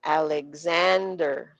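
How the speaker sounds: Alexander in slow motion, "zand" drawn out. Shown as a demonstration. Teacher showing students the stressed syllable, slow-motion Alexander. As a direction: stretch out Alexander slowly, holding the stressed "zand". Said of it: "Yun yung slow mo nun".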